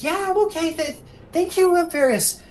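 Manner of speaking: put-on high-pitched child character voice; Seru. Big brother thanks his rescuer, high child voice. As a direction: pipe up like a grateful kid. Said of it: "Seru's like".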